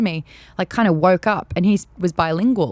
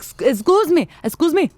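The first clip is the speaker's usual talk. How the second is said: put-on French accent; 6 semitones higher than usual. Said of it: "Oh, my French is terrible".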